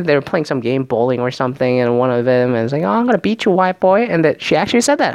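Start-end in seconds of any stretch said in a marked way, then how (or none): 2.8-4.0 mock taunt, pitched up high; put-on teasing challenge, higher; playful taunt. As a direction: imitate her trash talk in a raised voice. Retelling Jubilee's taunt during a game.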